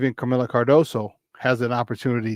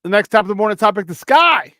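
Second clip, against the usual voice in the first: fast, higher, louder hype; excited intro; 8 semitones higher, 7 dB louder than usual. Real, not acted. Host introducing the Chicago Sky segment, hyped. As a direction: build up to the Sky.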